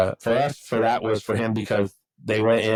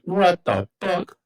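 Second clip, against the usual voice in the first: stunned disbelief; 7 semitones higher, much slower than usual. Retold or imitated. Hearing his dad died.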